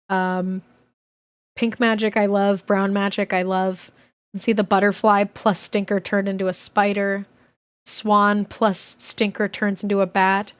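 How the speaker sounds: plain reading voice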